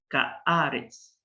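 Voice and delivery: "Kaaretz" very slow, with a long open "aa"; slow Hebrew word. Shown as a demonstration. Teacher modelling "Kaaretz" very slowly for students. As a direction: draw out the "aa" in "Kaaretz". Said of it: "Si empieza con gutural".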